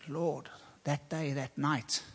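soft and slow, ashamed; hushed confession. Retold or imitated. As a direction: murmur it like admitting something to God. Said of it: "And I said".

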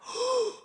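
high audible intake of breath; mock gasp of astonishment; shocked gasp. Retold listeners' shocked reaction.

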